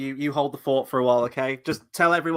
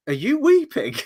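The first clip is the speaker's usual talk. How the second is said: high, loud, incredulous mock question; mocking Halbrand; 5 dB louder than usual. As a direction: tease him for crying, much higher and louder. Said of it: "Halbrand even calls him out".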